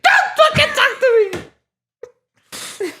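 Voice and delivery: very high, slow squealed outburst; hammed-up shrill rebuff; mock hysterical screech. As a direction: screech it like an upset schoolgirl. Acting out traumatized girl's snappy reply.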